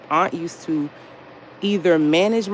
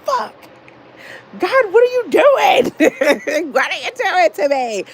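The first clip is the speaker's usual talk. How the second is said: exasperated plea; 12 semitones higher, 5 dB louder than usual. Retold or imitated. Pandemic blocked her move, so she wails up to God. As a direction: wail it at God, with a laugh in the middle. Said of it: "And I was like".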